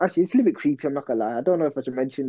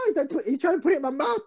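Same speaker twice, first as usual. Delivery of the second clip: ordinary delivery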